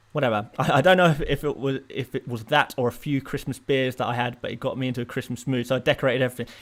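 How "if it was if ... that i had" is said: ordinary talk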